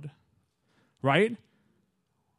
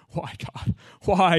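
mock despair; 4 semitones higher, much slower than usual. Baby starts crying.

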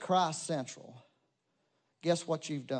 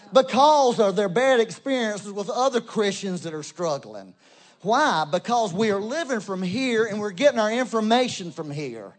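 loud, driving preacher's cadence, louder; preaching at full force; 5 semitones higher, 9 dB louder than usual. Acted. Pastor urging the congregation to look to heaven, full-force preaching. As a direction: preach it hard to the whole church.

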